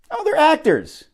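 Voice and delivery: high, surprised oh; surprised realization. Real, not acted. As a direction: suddenly get it.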